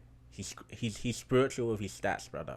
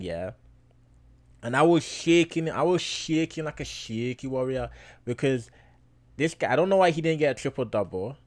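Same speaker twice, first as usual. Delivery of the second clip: loud, higher, near-shouting disbelief; hyped rant; 4 semitones higher, 9 dB louder than usual. Real, not acted. Vlogger to his viewers, reliving the close game, loud and fired up. Retelling the nerve-racking close matchup.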